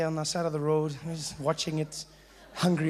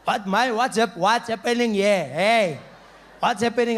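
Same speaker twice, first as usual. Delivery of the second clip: put-on drunk uncle; 2 semitones higher, 6 dB louder than usual. Drunk uncle barging into the party, loud rowdy greeting. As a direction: bellow it like a topless drunk uncle. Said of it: "There he was".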